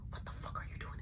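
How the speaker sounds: breathy hushing whisper so mama stays asleep; urgent whisper. Retold or imitated. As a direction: shush him in a tight whisper. Whispering at night.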